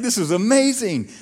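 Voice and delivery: bright and high, bursting with amazement; excited. Retold or imitated. Preacher voicing Paul thrilled that Gentiles are saved, high and excited. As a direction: cheer it like you can't believe your luck.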